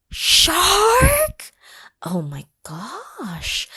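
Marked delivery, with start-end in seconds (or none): 0.0-1.5 high, loud gasp of disbelief; shocked squeal. Acted. Reading to kids, shocked by a shark plan, high and loud.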